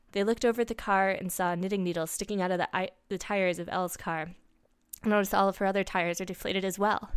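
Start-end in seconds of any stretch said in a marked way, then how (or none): none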